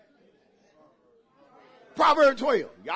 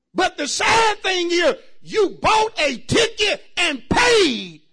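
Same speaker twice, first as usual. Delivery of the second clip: loud, higher shouted sermon; preaching at full force; 8 semitones higher, 9 dB louder than usual. Acted. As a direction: drive it home to the church. Shouted preaching about sad movies.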